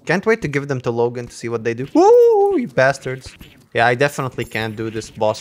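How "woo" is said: high, loud "woo"; startled whoop. Real, not acted. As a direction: whoop in sudden alarm. Startled by enemies.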